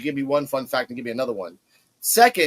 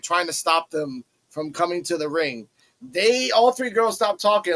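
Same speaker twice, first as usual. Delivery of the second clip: normal talking voice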